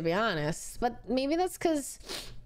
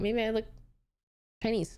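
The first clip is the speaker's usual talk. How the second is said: normal talking voice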